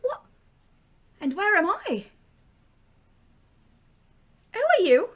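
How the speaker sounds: put-on Irish accent, rising to a high startled cry. Darlene wakes in a strange room, startled, with an Irish accent. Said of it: "subjected to one of my accents".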